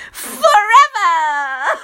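high, slow, cackling scream; screamed laugh. A narrator's reading. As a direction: shriek it like a mad witch. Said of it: "Loretta screams".

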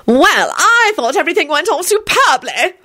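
high, pompous self-congratulation; puffed-up, high-voiced pride; proud announcement. A narrator's reading. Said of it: "Alfred announced proudly".

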